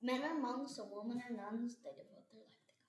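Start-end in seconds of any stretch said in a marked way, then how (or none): 1.8-2.8 barely voiced, trailing to a whisper; quiet whisper. Real, not acted. Kid whispering his line to the camera. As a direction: drop to a shy near-whisper. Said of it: "so don't get quiet on us".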